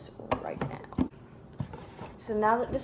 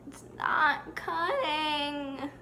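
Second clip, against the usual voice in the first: higher, slow, whining; whiny complaint; 8 semitones higher, much slower than usual. Real, not acted. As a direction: whine at the paper. Frustrated with the task.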